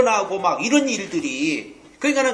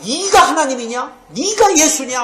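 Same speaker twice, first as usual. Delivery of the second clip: raised, loud voice of indignant accusers; angry challenge; 6 semitones higher, 6 dB louder than usual. Retold or imitated. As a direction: hurl it as an outraged accusation. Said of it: "화가 나가지고".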